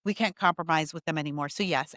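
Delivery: ordinary delivery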